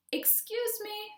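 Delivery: high, polite cricket voice; a tiny cricket clearing its throat; small cricket politely interrupting Gerald; cricket's little cough. A narrator's reading. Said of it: "coughed a cricket".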